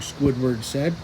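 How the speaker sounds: usual speaking voice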